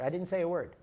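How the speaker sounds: ordinary talk, nothing marked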